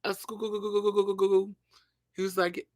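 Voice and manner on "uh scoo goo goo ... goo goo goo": high "goo, goo" babble; mock translator babble. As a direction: imitate a gibberish phone translator.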